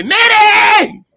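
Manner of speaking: high, loud drawn-out call; screaming at the TV, carried away; loud yell. Retold or imitated. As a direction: scream the name across the room. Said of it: "I was so loud".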